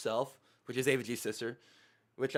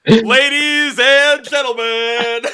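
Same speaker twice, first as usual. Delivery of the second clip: higher, slow showman voice; mock announcer; 10 semitones higher, 16 dB louder, much slower than usual. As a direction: announce it like a grand show host.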